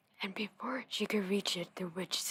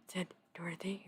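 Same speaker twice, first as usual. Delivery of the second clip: ordinary delivery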